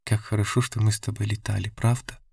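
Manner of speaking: soft, hushed confiding; hushed, tentative murmur to someone close; whispered. A narrator's reading. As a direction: whisper it tenderly, ending on an unsure question. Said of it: "и прошептала".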